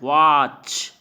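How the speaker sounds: वॉच with a lengthened "aw"; stretched vowel. Shown as a demonstration. Teacher repeating the example, वॉच drawn out. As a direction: hold the vowel before the "ch" in वॉच. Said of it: "यानी अ आ रहा है".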